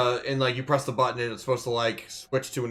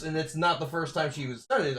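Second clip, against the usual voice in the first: usual speaking voice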